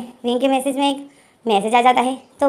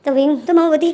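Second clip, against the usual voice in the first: ordinary delivery, nothing marked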